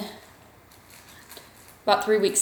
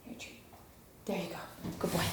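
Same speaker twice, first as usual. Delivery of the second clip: hushed treat giving; talking softly to a dog; 16 dB quieter, much faster than usual. Real, not acted. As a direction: murmur to the puppy. Quiet to puppy.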